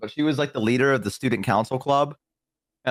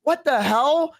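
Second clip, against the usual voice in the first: mock disbelief; 10 semitones higher, much slower than usual. Telling friend about dad's verdict, mock disbelief. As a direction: retell the shock, hammed up a little. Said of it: "and I was like".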